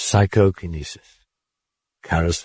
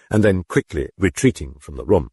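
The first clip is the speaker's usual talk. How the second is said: ordinary delivery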